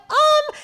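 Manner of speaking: very high drawn-out awkward um; mock stunned hesitation. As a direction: freeze in disbelief. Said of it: "I literally was like".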